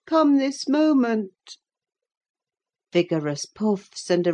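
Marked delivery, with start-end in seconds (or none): 0.1-1.6 put-on high, slow petulant order from a sickly boy; insist, slow and sulky; peevish command. A narrator's reading. Linton orders Joseph.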